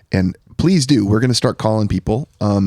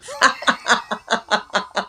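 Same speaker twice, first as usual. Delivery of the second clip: a light airy chuckle; kind gentle laugh; 13 semitones higher than usual. Real, not acted. Laughs at own exasperation.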